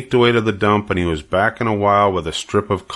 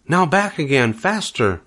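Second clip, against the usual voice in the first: urging on; 7 semitones higher than usual. Acted. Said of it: "said Charlotte".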